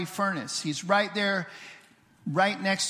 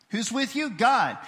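ordinary talk

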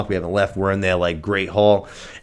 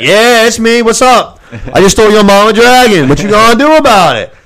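high, loud cocky taunt; cocky Aemon; 11 semitones higher, 10 dB louder than usual. Retold or imitated. Podcast host mocks cocky prince, loud and high. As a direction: strut it like a smug bully.

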